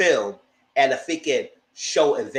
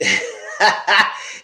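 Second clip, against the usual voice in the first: short, loud burst of laughter; 7 semitones higher, 5 dB louder than usual. Real, not acted. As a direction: break into a real laugh. Laughs at the dull episode.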